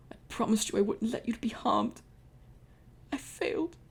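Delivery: high, breaking voice of guilt; mild tearful pharaoh; grieving pharaoh. Acted. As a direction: choke out the failure. Pharaoh grieves over lover.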